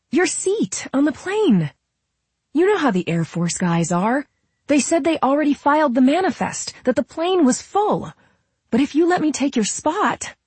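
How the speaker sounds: high, urgent, pleading voice; very high-pitched, rushing to stop someone; Camille. A narrator's reading. Camille stopping Nola as she leaves, high and urgent.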